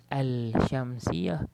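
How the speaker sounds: "Al-Syamsiyah" with the L wrongly pronounced before sh; mistaken sounded lam. Shown as a demonstration. Teacher showing what not to say, an audible L in "Al-Syamsiyah". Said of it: "Kita tak boleh bunyi".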